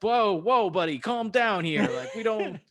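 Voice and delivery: loud, high hammed-up cop; mock cop shutting someone down; beat cop. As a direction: bluster it loudly as a comic officer. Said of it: "a beat cop who's like".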